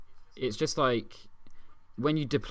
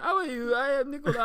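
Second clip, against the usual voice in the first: dim footballer voice; faster than usual. Pretend. Mocking Anelka as dim to questioning officials, high dopey voice. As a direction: play him as cheerfully clueless.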